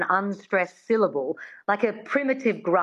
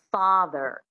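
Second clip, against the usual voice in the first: American father, r sounded at the end; standard American. Shown as a demonstration. Coach modelling for a learner, standard American accent. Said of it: "standard American, you'd go".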